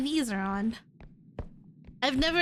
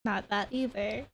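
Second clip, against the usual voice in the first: ordinary talk, nothing marked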